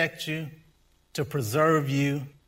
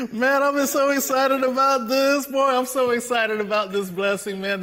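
high, laughing voice gradually settling; real excitement; 9 semitones higher, 5 dB louder than usual. Real, not acted. Preacher recovering from laughter to praise the blessing, excited. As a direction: come down from laughter, still thrilled.

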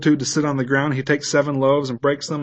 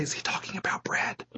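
whisper; 4 semitones higher, 14 dB quieter than usual. Acted. Disciples puzzled among themselves.

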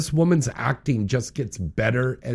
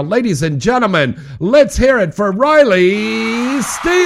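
loud, high showman announcement; announcer intro; 10 semitones higher, 11 dB louder than usual. Acted. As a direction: call her out like a game-show host. Host introduces guest on show.

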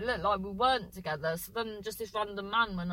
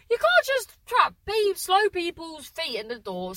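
much higher and louder scolding; indignant outburst; 8 semitones higher, 7 dB louder than usual. Retold or imitated. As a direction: protest hotly about her trapped foot. She tells fiance off, foot trapped.

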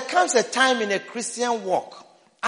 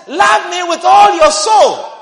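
loud, slow proclamation of God's words; preacher booming God's command; proclaiming shout; 7 semitones higher, 10 dB louder, slower than usual. Acted. Preacher to congregation, voicing God in a slow booming shout.

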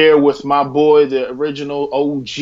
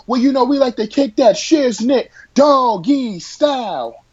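hype man; 12 semitones higher than usual. Acted. Hype intro of the hosts.

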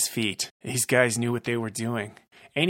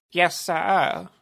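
higher, timid henchman; lieutenant obeys the boss, higher and meek; 7 semitones higher than usual. Acted. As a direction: answer like a cowed underling. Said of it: "Rumble said".

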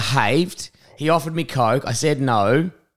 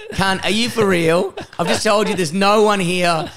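exasperated disbelief; 7 semitones higher than usual. Retold or imitated. Barman to mate ordering food, exasperated disbelief. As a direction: act out exasperation at a clueless mate.

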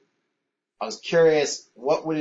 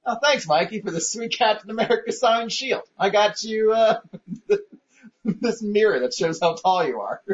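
mock gushing; 10 semitones higher than usual. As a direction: gush with fake delight. Acting out gift scenario.

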